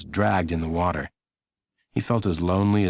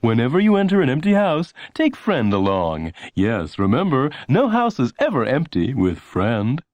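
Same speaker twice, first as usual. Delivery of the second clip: announcer voice; 5 semitones higher, 3 dB louder than usual. A narrator's reading. Announcer reminding Lenny before the empty house, salesy. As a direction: pitch it like a TV announcer. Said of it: "the announcer reminded him".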